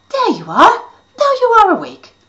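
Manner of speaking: high, loud teasing fairy; gleeful wake-up call; triumphant. Acted. Fairy wakes him with cloth.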